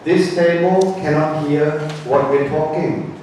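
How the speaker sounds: normal talking voice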